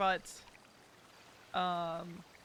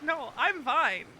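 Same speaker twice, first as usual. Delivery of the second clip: choked-up denial of crying; crying, worn out; 5 semitones higher, 5 dB louder than usual. Real, not acted. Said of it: "I can't stop crying".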